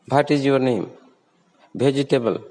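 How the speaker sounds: "What" and "Vegetable" begun with Hindi bha. Shown as a demonstration. Teacher showing the Indian mistake, bh in "What" and "Vegetable". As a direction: start "What" and "Vegetable" with a breathy b, bh. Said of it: "Or bha sound".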